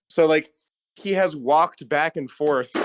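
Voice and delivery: ordinary talk, nothing marked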